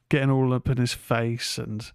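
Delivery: normal talking voice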